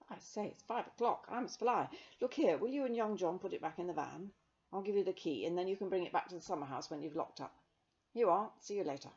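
put-on loud, brisk young man; hurried young man. A narrator's reading. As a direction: rush off cheerfully.

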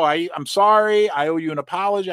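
usual speaking voice

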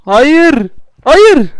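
high, loud frustrated yell; shouting no. Real, not acted. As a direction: scream no at the game. Game failure, shouting.

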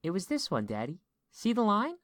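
put-on high, eager child voice; excited little girl. A narrator's reading. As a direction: tell Dad excitedly as a small daughter. Small daughter shows father her hand.

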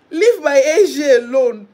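laughing protective shout; loud defence. Real, not acted. Repeats the demand louder, laughing.

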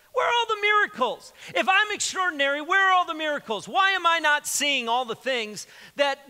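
high, pleading complaint; a believer crying out high for miracles; Gideon's complaint. Retold or imitated. Reading Gideon's complaint, acted.

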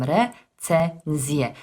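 "re, ce, nzie" as three separate beats, n opening the last; alternative syllable split. Shown as a demonstration. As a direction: pause after "re" and "ce", then "nzie" as one syllable. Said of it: "literkę N z drugiej sylaby przenieść". („re, ce, nzie” osobnymi sylabami, z n na początku ostatniej)

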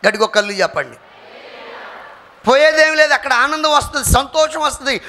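loud, high-pitched revival exhortation; booming pulpit call to make peace; fiery preaching. Acted. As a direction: preach it to the back row at full volume. Preaching at full force.

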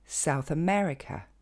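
South America à l'anglaise, ME plus marqué que South; accent secondaire sur South. Shown as a demonstration. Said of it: "va recevoir un accent tonique secondaire". (South America, double accent)

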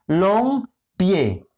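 balompié split slowly into ba, lom, pié; syllable by syllable. Shown as a demonstration. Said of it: "tiene tres sílabas". (balompié silabeado muy despacio)

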